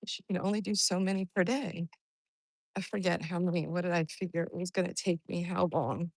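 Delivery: ordinary talk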